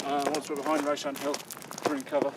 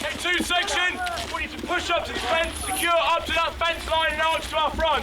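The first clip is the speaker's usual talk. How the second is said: shouted orders; 14 semitones higher than usual. Real, not acted. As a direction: yell the commands across the field. Section commander calling orders.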